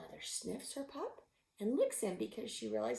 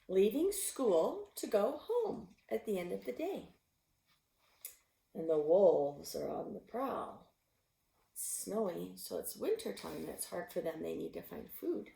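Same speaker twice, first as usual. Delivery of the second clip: ordinary delivery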